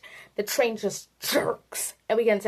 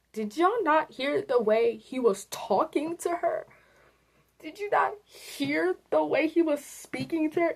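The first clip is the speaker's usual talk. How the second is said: indignant; 6 semitones higher than usual. Real, not acted. Indignant challenge to Poppy's haters.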